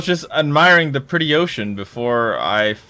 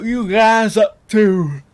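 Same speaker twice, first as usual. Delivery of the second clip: put-on voice; 7 semitones higher, 4 dB louder, slower than usual. Slow put-on voice.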